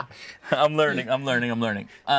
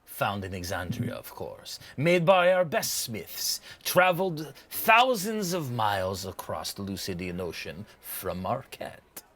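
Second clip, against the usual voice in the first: shopkeeper savoring the words, drawn out in places; boastful trader's showy pitch; proud merchant; slower than usual. Acted. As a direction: sell it grandly, with swells and drops. Game master voices merchant.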